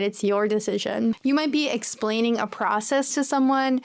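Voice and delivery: normal talking voice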